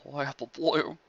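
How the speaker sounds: tearful. Acted. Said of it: "crying a little".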